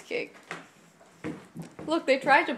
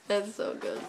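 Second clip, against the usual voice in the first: normal talking voice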